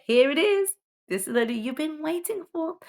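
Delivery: big excited build-up; excited reveal. Real, not acted. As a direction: announce the letter like a prize. Announcing the next letter.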